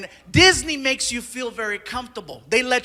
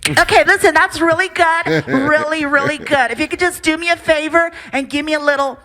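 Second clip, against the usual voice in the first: higher, boxy talkback voice; director over PA; 6 semitones higher, 4 dB louder than usual. Retold or imitated. Director to voice actor behind glass, tinny PA voice. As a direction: imitate a brisk director through a studio speaker. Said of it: "talk to me through a P A system".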